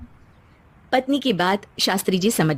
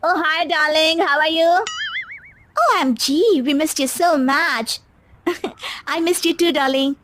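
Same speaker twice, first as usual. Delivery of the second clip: high, drawn-out gushing hello; gushing rich girls; 7 semitones higher, much slower than usual. Acted. Friends greeting Mohini, high and gushing. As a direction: coo over your best friend.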